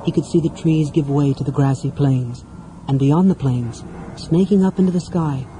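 plain reading voice

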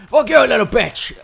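sudden high, very loud shout; mock attack yell. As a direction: holler it as you lunge at prey. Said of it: "and go".

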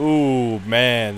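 ordinary talk

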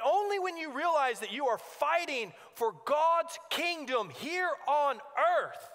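ordinary delivery

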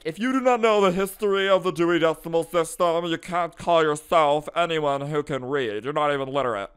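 high, loud, snooty lecturing voice; pompous know-it-all. Retold or imitated. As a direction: lecture like a smug history snob. Mocking pompous history lover.

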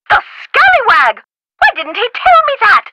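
high-pitched comic outrage; theatrical indignation. Acted. Professor learns he kept the skull secret, high indignant outburst. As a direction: exclaim it high, as if scandalised.

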